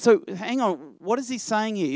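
normal talking voice

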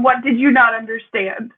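usual speaking voice

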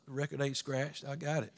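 ordinary talk, nothing marked

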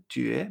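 觉 sliding upward like a question; puzzled rising tone. Shown as a demonstration. Teacher likens tone to surprise, 觉 rising questioningly. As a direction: raise 觉 as if surprised. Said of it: "me extraño".

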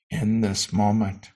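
slow, spacious meditation voice with long pauses; guided meditation. Acted. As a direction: lead them gently into the moment, unhurried. Leads a meditation.

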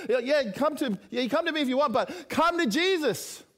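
high, urgent, sustained appeal; impassioned preaching. Real, not acted. Preacher urging the congregation to come to Jesus, impassioned. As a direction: plead at full pitch, punching each "come".

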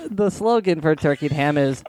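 ordinary talk, nothing marked

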